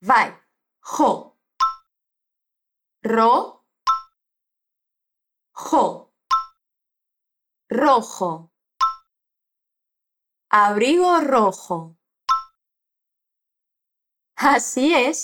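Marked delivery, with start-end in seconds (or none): 0.8-8.5 "Rojo" with trilled R and throaty J; spanish rojo. Shown as a demonstration. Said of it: "rasga a garganta". 10.5-11.9 slow model phrase. Shown as a demonstration. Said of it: "então vamos treinar".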